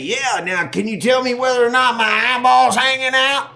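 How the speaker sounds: high-pitched, agitated hollering; re-enacted high, loud exasperation; frantic exasperated yelling. Acts himself, bitten, answering panicked buddy.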